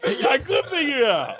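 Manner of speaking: laughing high. Real, not acted. Laughing self-quote.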